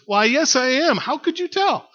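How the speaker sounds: high, loud surprised reply; acted woman calling up in surprise; calling back. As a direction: holler up, voice leaping on the question. Woman shouts up, surprised at guess.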